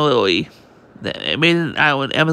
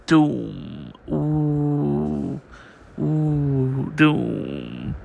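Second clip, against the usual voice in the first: ominous doom; 5 dB quieter, much slower than usual. Acted. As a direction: intone it like a gate of dread. Ominous prison gate sound effects.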